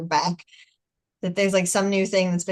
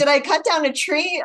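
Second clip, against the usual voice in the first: higher, mock-indignant kid; silly child indignation; 7 semitones higher than usual. Mother retelling how her daughter acted, mock indignant. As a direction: imitate a child's silly protest, pitched up. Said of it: "it was, like, really silly".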